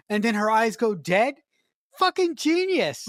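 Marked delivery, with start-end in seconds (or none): 2.0-3.1 high, thrilled outburst; excited praise. Real, not acted. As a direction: burst out in admiration. Raving about the film twist.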